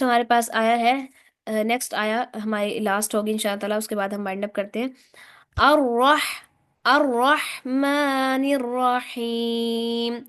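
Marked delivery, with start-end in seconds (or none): 5.6-10.2 अर्रहमानिर्रहीम chanted slowly with a doubled, heavy र; slow Quran recitation. Shown as a demonstration. Teacher models the verse for students to repeat. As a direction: hold the vowels and hit the shadda. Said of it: "दोहराएं, सुनाएं".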